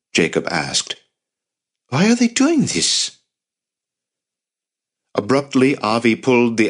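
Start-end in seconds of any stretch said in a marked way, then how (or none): 1.9-3.1 very high-pitched anguished question; distressed questioning. A narrator's reading. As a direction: voice leaps up in bewildered distress. Distressed nephew questions the violence.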